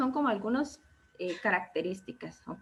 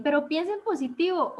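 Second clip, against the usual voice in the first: mock cheerful advice; 6 semitones higher, 3 dB louder than usual. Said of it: "Y alguien".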